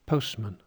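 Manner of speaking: postman with men weakened to mun, falling tune; unstressed schwa ending. Shown as a demonstration. Teacher showing plural matches singular, postman with weak falling mun. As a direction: say postman as POHST-mun, dropping at the end. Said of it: "Instead of man and men, I say postman".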